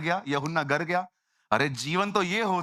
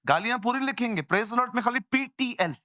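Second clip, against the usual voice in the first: ordinary delivery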